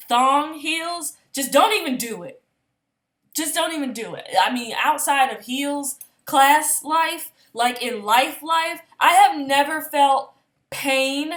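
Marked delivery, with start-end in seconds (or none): 0.1-4.3 emphatic warning. Real, not acted. Emphatic warning about thong heels. 9.0-11.3 loud, drawn-out insistence; emphatic. Real, not acted. Emphatic about the pain.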